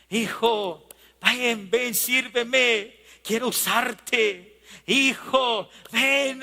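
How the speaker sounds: tender start building to a high, urgent cry; Dios pleading. Acted. God calling his stubborn child back, plea building to a cry. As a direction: begin gently, then climb into impassioned preaching. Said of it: "y nos dice".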